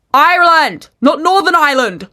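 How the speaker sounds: loud, high outraged retort; exasperated shout. Retold or imitated. Traveller corrects official about Ireland, exasperated high shout. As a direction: burst out, hammering "Ireland".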